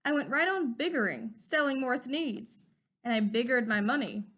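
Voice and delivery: plain reading voice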